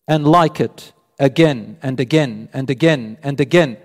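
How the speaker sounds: ordinary talk, nothing marked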